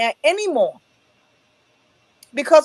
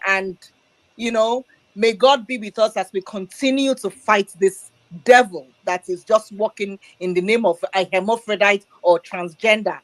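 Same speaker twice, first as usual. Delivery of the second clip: ordinary delivery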